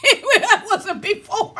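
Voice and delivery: high, slow words broken by laughter; laughing. Real, not acted. Vlogger laughing at herself for tidying the desk, laughing through words. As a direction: laugh at yourself mid-sentence.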